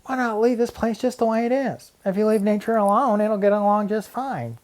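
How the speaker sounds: high, loud shout to stop everyone; boy shouting at his arguing classmates to leave nature alone. A narrator's reading. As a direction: yell it high and loud, holding them back. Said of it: "Then Arnold shouted".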